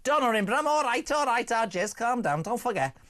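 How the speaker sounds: high-pitched jokey Scouse; hammed-up Liverpool accent. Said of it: "you're killing me with your accent".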